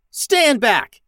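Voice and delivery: high, forceful warning shout; yell. A narrator's reading. Captain about to blast the door, yelling a warning. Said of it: "yelled Max".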